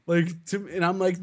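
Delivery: ordinary talk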